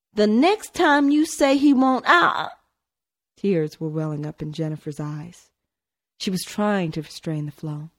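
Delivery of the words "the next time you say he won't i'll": put-on higher, louder boy's angry threat. A narrator's reading. Tony threatens his sister with a fist raised, loud angry. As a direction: cut yourself off mid-threat. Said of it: "shook a fist in her face".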